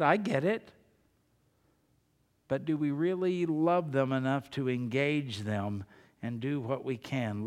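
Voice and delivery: normal talking voice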